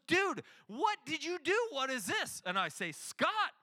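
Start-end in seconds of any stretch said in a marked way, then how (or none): 0.1-2.4 high, loud angry demand; storming in angry. Retold or imitated. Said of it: "comes storming into the office". 2.9-3.5 raised, louder self-justifying plea; defensive pleading. Retold or imitated. Defending himself to angry Scott.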